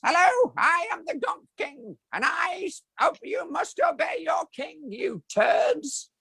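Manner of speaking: very high, slow, pompous royal decree; squeaky tyrant king barking orders; shrill puppet king, shouting the last words; Gonk King voice. Shown as a demonstration. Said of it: "But I can do the voice".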